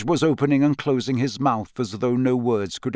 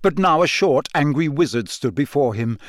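ordinary delivery, nothing marked

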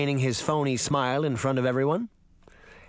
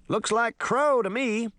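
high, slow portly sheriff; a puzzled lawman peering closely; sheriff's voice; 10 semitones higher than usual. A narrator's reading. Sheriff studying the feather, slow high voice.

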